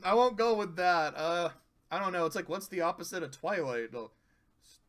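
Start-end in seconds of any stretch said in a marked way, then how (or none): none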